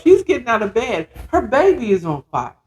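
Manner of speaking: ordinary talk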